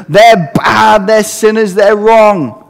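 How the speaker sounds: mock accuser denouncing the believers, loud and high; accuser's voice. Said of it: "the accuser of the brethren".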